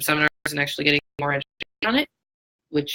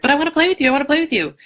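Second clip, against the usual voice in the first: high, loud whiny pleading dog voice; pushy pleading dog; 10 semitones higher, 4 dB louder, much faster than usual. Retold or imitated. Voicing a dog who begs another dog to play, high and whiny. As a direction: beg like an eager pup who won't take no. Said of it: "another dog is like".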